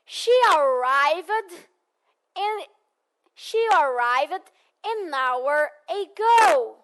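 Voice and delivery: "She arrived an hour ago" said very slowly, linking "an hour"; slow model sentence. Shown as a demonstration. Teacher models the sentence slowly for the class. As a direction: spell it out slowly so "an hour" is clear. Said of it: "Repitam comigo, por favor".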